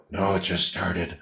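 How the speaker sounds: low, very quiet, slow rasp; raspy, deep patient. Acted. Patient answering about a voice change, raspy and deep. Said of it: "normally that raspy and deep".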